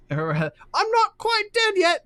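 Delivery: high, slow clown voice; silly clown. Shown as a demonstration. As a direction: squeal it like a stubborn clown. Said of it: "that's what he says".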